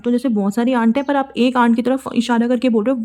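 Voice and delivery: ordinary talk, nothing marked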